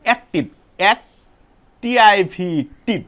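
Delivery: অ্যাক্টিভ broken into its syllables, এক then টিভ, with a pause between them; teacher splitting অ্যাক্টিভ into two beats; syllable split demo. Shown as a demonstration. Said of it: "তোমরা খেয়াল করো".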